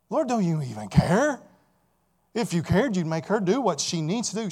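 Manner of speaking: Martha complaining. Retold or imitated. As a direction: plead your case, sure you're right. Martha's prayer voiced by preacher.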